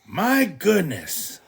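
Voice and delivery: high, slow shocked exclamation; slow dismayed father; surprised Papa. Acted. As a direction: gasp it out in dismay.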